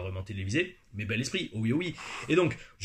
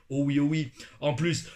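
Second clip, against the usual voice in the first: ordinary delivery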